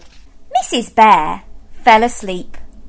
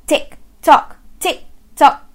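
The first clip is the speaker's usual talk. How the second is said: clock ticking; 5 semitones higher than usual. Acted. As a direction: tap out a clock beat. Clock continues.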